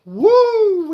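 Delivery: high, loud cheer for Friday; real Friday whoop; excited woo. Real, not acted. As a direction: let out a happy whoop. Greeting chat on Friday.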